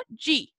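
G sounded as Portuguese "dji"; final DE as "dji". Shown as a demonstration. Teacher summing up the rule, final DE pronounced "dji". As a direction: say G like English "jee". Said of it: "la voy a pronunciar siempre como".

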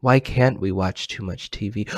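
ordinary talk, nothing marked

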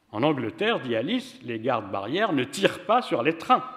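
plain reading voice

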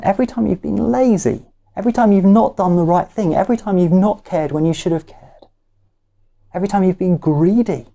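high, fast, piling up the list; building fervour. Real, not acted. Preacher piling up a congregation's sins, fast and rising. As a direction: rattle off each sin, climbing.

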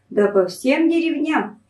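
ordinary delivery, nothing marked

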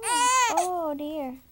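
loud, hammed-up wail of pity; overdone sympathy cry; mock dismay. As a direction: pretend to grieve for the baby's kitty. Mock dismay over baby's kitty.

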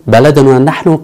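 usual speaking voice

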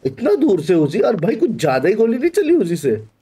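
ordinary delivery

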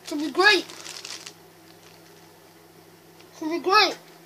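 loud, muffled through a full mouth; cheeks stuffed full. Real, not acted. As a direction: push the words out past a mouthful. Grapes stuffed, straining loud.

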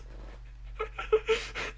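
high airless wheeze of laughter; helpless squeaky wheeze; wheezing silent laugh. Real, not acted. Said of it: "That laughing though".

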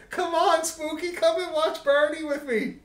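put-on high, loud child's plea; imitating little girl. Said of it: "my niece Alex was about three and a half".

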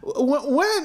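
stammered, high-pitched question; shocked questioning. Acted. Father told his grandson died, shocked stammer. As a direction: blurt it in stunned disbelief.

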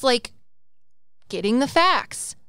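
normal talking voice

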